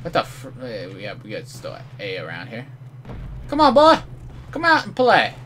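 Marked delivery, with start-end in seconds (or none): 3.5-5.3 very high, loud mocking taunt; taunting call. Real, not acted. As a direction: call him out like a playground bully. Taunting an enemy to come out.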